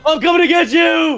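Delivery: high, loud attack cry; chasing shout. Real, not acted. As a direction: yell it as you charge. Chasing the other player.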